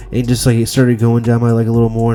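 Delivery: ordinary talk, nothing marked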